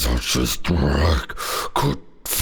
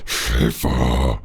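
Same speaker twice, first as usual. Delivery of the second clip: ordinary talk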